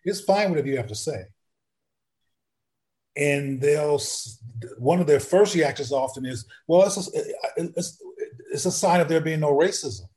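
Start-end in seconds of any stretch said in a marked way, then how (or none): 0.0-1.2 higher, softer reassuring voice; lightly acted gentle coaxing of a child; neutral, encouraging. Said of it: "make my posture as neutral as possible". 6.7-10.1 imitating a child's halting answer, pitched higher; lightly mimicked hesitant youngster; stuttering first reaction. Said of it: "like, stuttering and".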